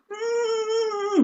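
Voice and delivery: long high "mm" whine; panicked hum. Retold or imitated. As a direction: hold a tense, high hum. Wordless panic while needing to act.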